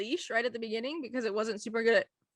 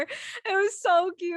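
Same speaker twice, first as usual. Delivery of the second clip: usual speaking voice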